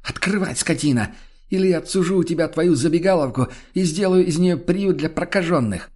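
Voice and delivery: high-pitched angry tirade; furious threat. A narrator's reading. Woman threatens to take the tavern, furious.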